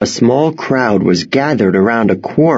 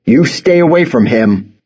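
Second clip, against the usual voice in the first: slightly raised, firm fatherly warning; stern dad voice; 4 semitones higher than usual. A narrator's reading. Father warns the farmer off his son, sternly. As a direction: warn him off like a stern dad. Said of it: "doing his best stern dad voice".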